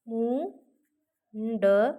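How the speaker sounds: मुंडन drawn out very slowly, न sound of the anusvara held; slow demo pronunciation. Shown as a demonstration. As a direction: stretch मुंडन slowly to show the न. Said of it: "न चा उच्चार होतोय का नाही". (मुंडन सावकाश, अनुस्वार लांबवून)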